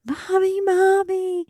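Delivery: put-on high little toddler calling Mummy; child calling out. Mum imitating her toddler calling at night, high child voice. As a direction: pitch it up like a small child calling. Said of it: "I wake up to her".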